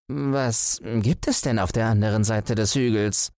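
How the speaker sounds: high, curious tortoise voice; tortoise asking with interest. Acted. Tortoise asks the hare.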